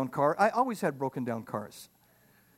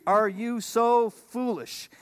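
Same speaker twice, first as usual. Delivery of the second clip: very slow, higher, stressed "so"; incredulous Paul; 5 semitones higher, much slower than usual. Retold or imitated. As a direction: drag out the disbelief. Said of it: "So he says down in verse three".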